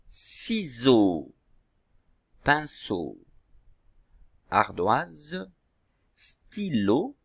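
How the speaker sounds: slow, carefully separated words; teacher calling out a word list slowly; word dictation. Shown as a demonstration. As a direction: dictate each word on its own, drawn out. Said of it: "je vous dis le nom".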